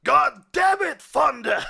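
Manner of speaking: roaring captain. Acted. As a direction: roar it at your reckless cop. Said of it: "a roaring but familiar voice screams at you".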